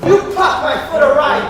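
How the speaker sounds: ordinary talk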